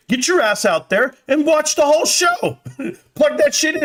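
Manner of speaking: high-pitched, hammed-up demand; rally them with rising, over-the-top insistence; mock drill-sergeant pep. Streamer ordering viewer to watch the show, high mock-outrage.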